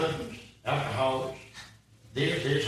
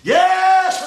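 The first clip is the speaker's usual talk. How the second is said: jubilant shout; 11 semitones higher, 10 dB louder than usual. Real, not acted. Jubilant exclamation after a laugh.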